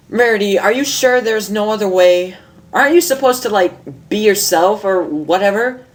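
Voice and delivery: a hint of a brash young mare; Rainbow Dash. Reads Rainbow's lines in voice.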